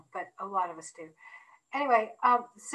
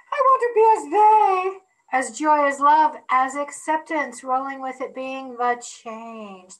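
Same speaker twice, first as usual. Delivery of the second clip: grand poetic declaration; 3 semitones higher, 6 dB louder than usual. Pretend. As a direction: declaim it like an old poem. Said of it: "I said that old-fashioned like".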